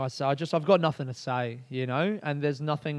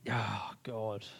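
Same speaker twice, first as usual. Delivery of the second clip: soft, low, whispered groan; weary sighed aside; 5 semitones lower, 9 dB quieter than usual. Real, not acted. Comedian recalling the shit show, sighing. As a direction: sigh it out under your breath.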